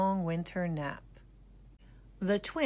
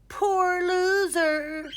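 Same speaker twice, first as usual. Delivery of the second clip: high, loud, slow gloat; 13 semitones higher, 8 dB louder, much slower than usual. A narrator's reading. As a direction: rub it in. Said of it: "said Gregory".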